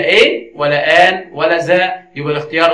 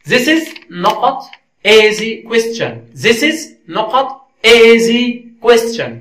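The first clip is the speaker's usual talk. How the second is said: very slow, higher-pitched quiz reading; slow dictation; 4 semitones higher, much slower than usual. Shown as a demonstration. Teacher reading a gap-fill question to learners, slow dictation. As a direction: read it out slowly, word by word, lifted before the gap.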